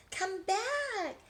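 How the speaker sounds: high, loud pleading lamb voice; lamb crying out. A narrator's reading. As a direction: call after him in a high lamb's voice. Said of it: "she cried".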